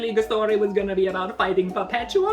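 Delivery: laughing fake Italian Batman; bad Italian accent. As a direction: gloat in a cartoon Italian voice. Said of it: "doing a bad Italian accent".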